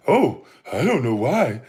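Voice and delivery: mock soft-spoken educated innocence. Ranter mimicking critic who tricks people, mock soft and educated. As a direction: imitate someone feigning polite puzzlement. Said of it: "Try to talk all soft and educated".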